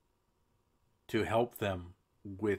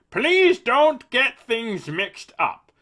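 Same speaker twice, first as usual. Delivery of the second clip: much higher, louder cartoon politician; silly Juncker voice; 13 semitones higher, 13 dB louder, much slower than usual. Host reads the EU president's quote in a silly voice. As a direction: lampoon him with a goofy pompous voice. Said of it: "make him sound silly".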